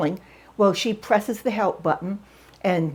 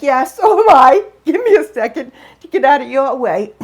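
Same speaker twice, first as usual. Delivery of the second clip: loud, high, laughing reply; giggling at her own mistake; 5 semitones higher, 9 dB louder than usual. Real, not acted. Answering the driver she is blocking, laughing. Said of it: "So I'm already laughing".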